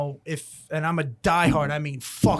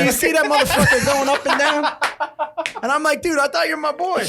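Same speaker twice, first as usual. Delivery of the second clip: laughing; 10 semitones higher, 5 dB louder than usual. Real, not acted. Laughing retelling, mock complaint to friend.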